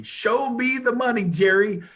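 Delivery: hammed-up movie catchphrase; movie quote. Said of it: "like the Jerry Maguire movie".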